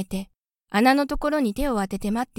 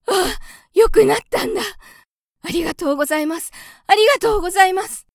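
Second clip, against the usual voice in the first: much higher, louder, bursting with thanks; overjoyed little mouse; 10 semitones higher, 7 dB louder than usual. A narrator's reading. Cured little mouse thanking Gauche, high and excited. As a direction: squeal your gratitude. Cured child runs joyfully.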